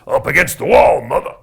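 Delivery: loud, high mock outburst; hammed-up yell from across the room; mock shout. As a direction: belt it out like a punchline. Joking exclamation about wall sound.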